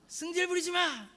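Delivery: high-pitched mock angry snap; 성질내는 목사님 흉내. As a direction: imitate the pastor losing his temper. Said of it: "본인이 승질 내셨지?". (높은 소리로 버럭 성질내는 흉내)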